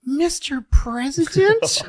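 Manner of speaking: a sweet, breathy falsetto question; Jackie, puzzled. Retold or imitated. Comic imitating Jackie answering LBJ, high-pitched. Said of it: "you hear her go".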